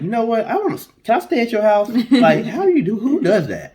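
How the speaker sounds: high mock voice of a pushy stranger; hammed-up high impression of a nosy visitor; mocking the pushy outcast. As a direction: squeak it out like a cheeky guest. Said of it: "It's like".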